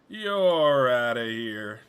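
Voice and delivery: very drawn-out, loud dismissal; big playful send-off, sliding down in pitch; hammed-up ejection call. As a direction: stretch it out like a mock umpire. Repeats the ejection call, bigger.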